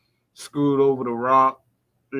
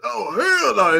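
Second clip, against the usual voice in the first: imitating his voice; 8 semitones higher, slower than usual. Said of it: "in his voice".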